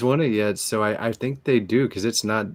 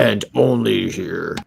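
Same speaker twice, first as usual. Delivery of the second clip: trailer voice; slower than usual. Pretend. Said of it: "the trailer voice guy".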